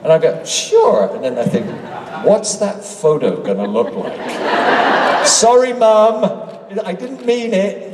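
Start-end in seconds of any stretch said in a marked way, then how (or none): 0.4-1.1 high, over-obliging reply; mock agreeable. Actor agreeing to a fan's odd photo request, over-obligingly. 2.2-5.2 low, slow, wondering aside; dubious afterthought. Retold or imitated. Actor privately doubting the photo he agreed to, dubiously. 5.2-7.9 high, drawn-out, sheepish plea; mock apology. Joking apology to Mum over a photo, sheepishly.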